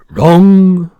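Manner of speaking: loud booming cry; giant crying out. Acted. Giant correcting the girl with a loud cry. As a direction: shout it out, slapping a thigh. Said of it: "cried the giant".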